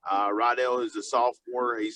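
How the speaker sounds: normal talking voice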